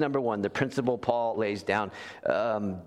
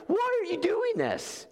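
much higher and slow, stunned question; bewildered. Acted. Jean Valjean to the bishop, stunned and much higher. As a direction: let it rise in total disbelief. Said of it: "He's like".

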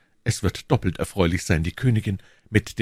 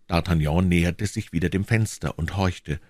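plain reading voice

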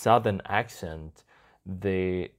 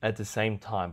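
normal talking voice

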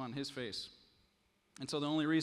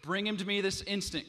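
higher and louder urgent command; the king cries out impatiently; cried out; 6 semitones higher, 7 dB louder than usual. Acted. King demands the thief be brought at once, crying out. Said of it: "cried the king".

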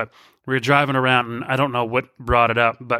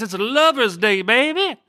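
hyped-up announcement; 9 semitones higher than usual. Pretend. Host hyping Valentine's episode to listeners, hyped-up. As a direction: crow it like a love-song deejay.

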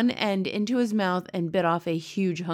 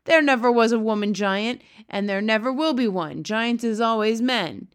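louder insistent giant; 3 semitones higher, 6 dB louder than usual. Acted. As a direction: hammer it home to the girl. BFG continues shouting.

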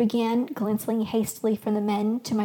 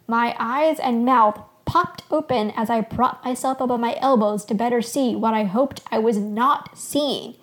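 usual speaking voice

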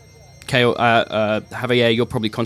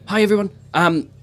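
higher, hesitant greeting to an audience; nervous stage introduction; 7 semitones higher than usual. Acted. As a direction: introduce yourself haltingly from the stage. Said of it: "Lyra on stage saying".

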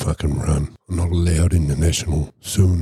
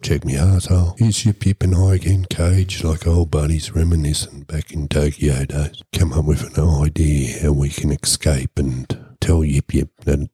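ordinary talk

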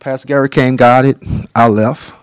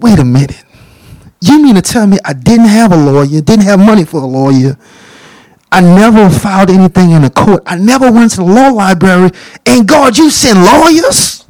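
rising higher and louder to amazement; stunned disbelief; 6 semitones higher, 3 dB louder than usual. Retold or imitated. Prisoner stunned that lawyers came to free him, rising disbelief. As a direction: build to an astonished cry.

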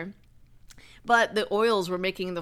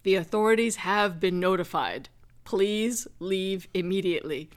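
lightly imitated recorded security announcer; booming alarm voice. House alarm warning the family to leave, booming announcement. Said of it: "loud, booming, deep male voice".

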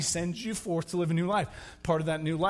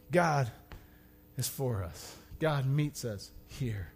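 tender close; 8 semitones lower, 9 dB quieter than usual. Acted. Pastor closing softly over music, tender. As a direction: hush it down like a prayer.

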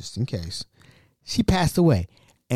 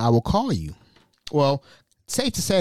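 usual speaking voice

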